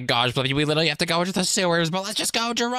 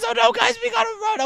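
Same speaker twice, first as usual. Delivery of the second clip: put-on much higher, louder kid yelling in fright; panicked kid; 14 semitones higher, 3 dB louder, faster than usual. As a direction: squeal that they must flee. Kid panics after warning.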